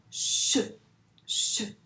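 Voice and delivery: "Ch" as a long unvoiced hush, repeated; drawn-out hushing "ch"; isolated ch sound. Shown as a demonstration. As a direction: demonstrate "ch" alone, shhh. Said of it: "Donc le son ch".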